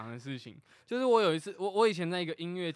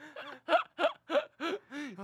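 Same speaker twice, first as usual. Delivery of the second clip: wheezing laugh; 10 semitones higher than usual. Real, not acted. Laughing hard.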